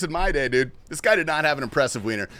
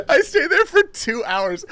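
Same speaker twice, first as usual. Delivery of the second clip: much higher, slow, whiny half-sob; mock crying; 13 semitones higher, 7 dB louder than usual. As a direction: wail it like a hammed-up cry. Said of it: "and I stayed there for two hours".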